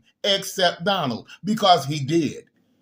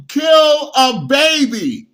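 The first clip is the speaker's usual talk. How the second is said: high, loud and slow; indignant slow shout; outraged; 7 semitones higher, 8 dB louder, much slower than usual. Real, not acted. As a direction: stretch it out in disbelief. Indignant question about abortion.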